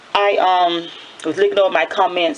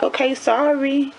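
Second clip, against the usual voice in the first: mock apology; 8 semitones higher than usual. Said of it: "And I'm like".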